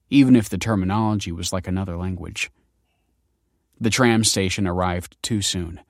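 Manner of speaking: ordinary delivery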